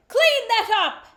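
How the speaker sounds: furious royal roar; thundering king. A narrator's reading. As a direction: bellow it like an angry king. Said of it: "Herod thundered".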